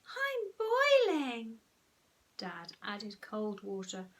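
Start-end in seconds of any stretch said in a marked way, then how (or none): 0.1-1.6 very slow, high-pitched wail; whiny moan. A narrator's reading. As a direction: stretch the complaint out, high and whiny. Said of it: "moaned Peter".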